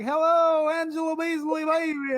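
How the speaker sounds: loud, high showman hello; excited host greeting. Retold or imitated. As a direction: greet the viewers like a hyped host. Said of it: "I would be like".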